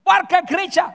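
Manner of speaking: high, loud call-out; emphatic shout. Real, not acted. Preacher naming church members as hypocrites, emphatic shout. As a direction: shout it out at the congregation.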